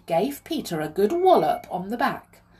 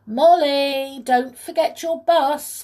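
loud, high, drawn-out call; 8 semitones higher, 9 dB louder, slower than usual. A narrator's reading. Voice from the house reminds Molly, calling out. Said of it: "called a voice from the house".

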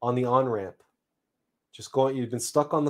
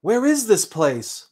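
higher, louder questioning cry; bewildered seed; 9 semitones higher, 6 dB louder, slower than usual. Acted. Seed wakes in the earth and wonders aloud, bewildered. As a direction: call out as a lost, puzzled seed. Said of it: "I thought aloud".

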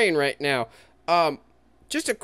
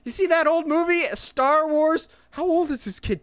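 put-on high, eager kid voice; excited teen; 12 semitones higher than usual. Fan imitating Spider-Man mid-fight, high and eager. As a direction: blurt it like an overexcited fanboy.

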